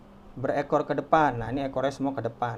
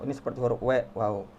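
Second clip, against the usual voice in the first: ordinary talk, nothing marked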